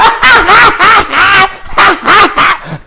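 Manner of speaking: loud, high-pitched mock cackle; long imitated fit of dying laughter; hysterical laughing. Girl imitated cracking up at the sisters, hysterical laughing. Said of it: "And she would die laughing".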